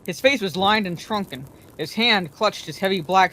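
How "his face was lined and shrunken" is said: usual speaking voice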